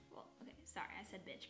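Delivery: usual speaking voice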